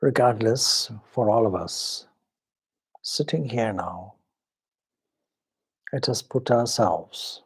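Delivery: ordinary talk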